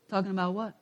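soft, mock-innocent question; lightly imitated wife acting clueless; playing dumb. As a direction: quietly feign not knowing, imitating a wife. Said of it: "eventually she's playing dumb".

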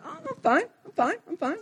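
defensive dodge. Retold or imitated. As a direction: brush it off nervously, pitch jumping up. Husband dodging wife's question.

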